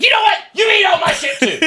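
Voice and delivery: very high, loud screech; hammed-up outraged retort at the kids; angry dad comeback. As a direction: blow up in a squealing mock rant. Acted quarrel with his kids.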